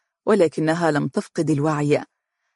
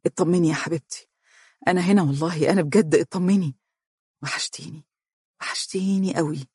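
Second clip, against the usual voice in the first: reassuring. A narrator's reading. Husband comforting his crying wife, tender fading reassurance. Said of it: "وهو يقول مطمئنا".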